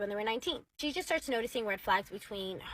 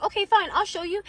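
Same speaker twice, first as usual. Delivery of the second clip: high, loud, fed-up retort; exasperated giving-in; 9 semitones higher, 8 dB louder than usual. Retold or imitated. Husband giving in to wife's demand, exasperated. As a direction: give in with mock exasperation. Husband gives in during retold quarrel.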